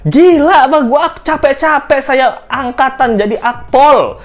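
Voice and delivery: high, loud, exasperated griping; mock-indignant rant. Host griping as an overqualified police aide, mock-indignant.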